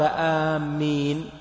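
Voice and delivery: آمين drawn out as aam-meen, m doubled; wrong doubled m. Shown as a demonstration. Teacher showing an invalid prayer word, آمين with doubled m. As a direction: say آمين slowly with a shadda on the m. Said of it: "ما صحت".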